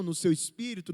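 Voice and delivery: normal talking voice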